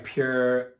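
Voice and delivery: ordinary delivery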